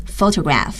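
"photograph" with the stress on the first syllable, PHO-to-graph. Shown as a demonstration. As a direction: lean on the opening syllable of "photograph". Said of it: "它的重音在词语的最前面".

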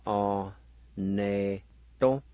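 "or, ne, to" with gaps between the syllables; syllable-by-syllable pronunciation. Shown as a demonstration. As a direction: teach "or, ne, to" one piece at a time. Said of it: "now pronounce these words".

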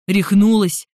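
loud, rude are-you-crazy; blunt retort. Acted. Jeremy rudely questions Samantha's sanity, loud blunt retort. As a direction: fire it back bluntly and loud. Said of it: "Джереми не стеснялся в выражениях".

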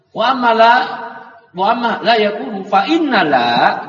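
loud clear recitation of wa amma la yakunu fa innala; confident teacher recitation of the Arabic; model reading. Shown as a demonstration. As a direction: read wa amma la yakunu fa innala out firmly as the example.

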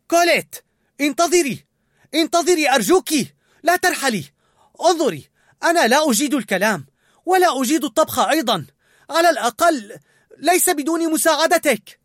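begging her not to go; shouting pleas. A narrator's reading. Linguini begging Colette at her motorbike, loud pleas. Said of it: "فصاح عاليا".

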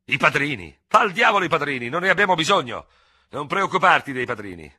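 high, loud, impetuous tirade; heated impatience. A narrator's reading. Ferraud insists on duelling at once without seconds, impatiently shouting. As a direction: sweep every objection aside, nearly shouting. Hothead brushes aside objections to duel.

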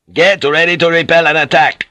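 loud, high battle command; shouted order. Acted. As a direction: shout it across the deck. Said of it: "he shouted".

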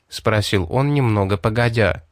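ordinary delivery, nothing marked

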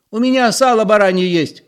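high-pitched eager offer; high, called-out announcement. A narrator's reading. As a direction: pipe up to the group. Zoya calling out her offer.

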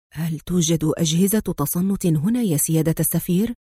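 plain reading voice

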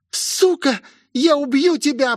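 очень высокий яростный выкрик; прорычи угрозу на высокой ноте; яростный рык. A narrator's reading. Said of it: "прорычала девушка". (рычать в ярости)

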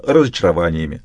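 usual speaking voice

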